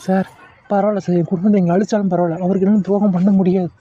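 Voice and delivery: usual speaking voice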